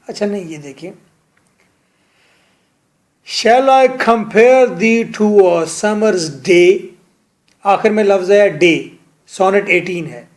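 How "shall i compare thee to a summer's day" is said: slow, measured recital of the line; poem recitation. Shown as a demonstration. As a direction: read it like verse, drawing each word out. Teacher recites a sonnet line. (धीमे, कविता की तरह पाठ)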